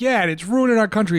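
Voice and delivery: lightly hammed-up fired-up woman; imitating the lady. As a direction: pitch up like an eager crank. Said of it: "She was like".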